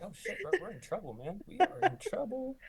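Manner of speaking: high, hurried voice breaking into laughter; laughing mock alarm. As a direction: play the panic for laughs. Retells shared panic, laughing.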